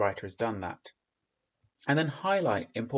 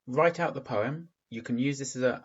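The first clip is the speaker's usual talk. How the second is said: ordinary talk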